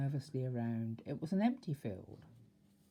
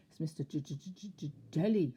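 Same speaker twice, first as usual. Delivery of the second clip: stuttering on the J, shaky; nervous stammer. A narrator's reading. Mister Jelly telling the tramp his name, nervous stammer. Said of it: "stammered Mister Jelly".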